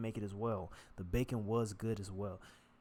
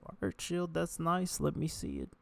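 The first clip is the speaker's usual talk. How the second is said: higher mock voice egging himself on; mocking self-talk; 7 semitones higher, slower than usual. Talking to himself, mocking higher self-encouragement. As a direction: tease yourself, higher pitched. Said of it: "this is me saying this to myself".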